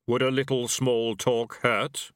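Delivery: unhurried Death, every word weighed; grim reaper voice. A narrator's reading.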